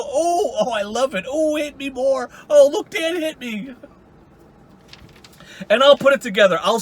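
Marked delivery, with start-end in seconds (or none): 0.0-3.8 higher, slow, hammed-up moaning; mock him as enjoying the hits; mock pleasure moans. Teasing Wally about wanting to be hit, in higher mock moans. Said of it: "you go".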